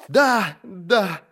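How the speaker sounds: high, frightened double blurt; frantic assent. A narrator's reading. Prisoner confessing to hiring the killer, frantic assent. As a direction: agree frantically, pitched high.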